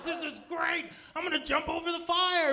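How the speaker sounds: high-pitched, loud exhilaration; hammed-up giddy announcement; manic groom. Groom wants to jump the fire, giddy excitement.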